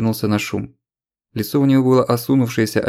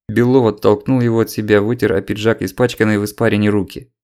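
usual speaking voice

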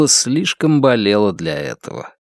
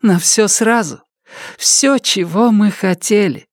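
much higher, awed and breathless; awed high female voice; 9 semitones higher than usual. Acted. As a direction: voice full of wonder, pitched up. Cassandra answers in her voice.

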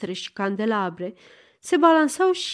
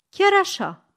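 defiant; 9 semitones higher, 3 dB louder than usual. A narrator's reading. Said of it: "o sfidă".

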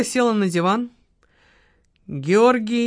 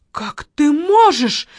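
high, slow, loud hurt cry; wounded outcry at her lover; 9 semitones higher, 7 dB louder, slower than usual. A narrator's reading. Margarita cries out at her lover, high and loud. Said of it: "вскрикнула Маргарита".

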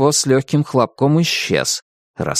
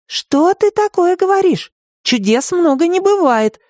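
put-on a girl's indignant protest; indignant Katya. A narrator's reading. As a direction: bristle at the idea. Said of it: "возмутилась Катя".

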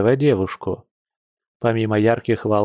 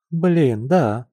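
raised pitch, falling like a sigh; sighed regret; 4 semitones higher than usual. A narrator's reading. Masha remembers their turn, mildly sighing regret. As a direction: let it out as a mild sigh. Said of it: "вздохнула Маша".

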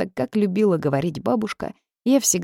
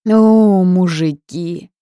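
very loud, drawn-out slow cry; exasperated outburst; 3 semitones higher, 9 dB louder, much slower than usual. A narrator's reading. Heroine rants at the house spirit, loud exasperated cry. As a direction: shake your fist at all men. Heroine shakes fist at men.

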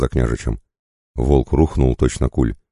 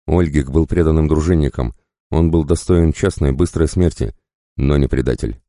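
ordinary delivery, nothing marked

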